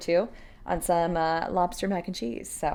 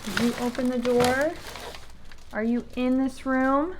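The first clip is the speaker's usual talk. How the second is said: lightly raised voice coaxing the dog; asking the dog gently, pitched up; high pet talk; 5 semitones higher than usual. Real, not acted. Owner asks her dog about the door, light pet voice.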